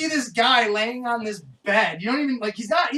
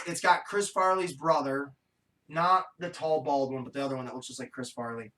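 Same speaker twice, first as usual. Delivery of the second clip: normal talking voice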